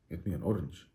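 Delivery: soft, low, baffled repeat; hushed, low bewilderment; puzzled echo. Acted. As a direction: echo the order quietly in mock disbelief. Acts baffled friend echoing the demand.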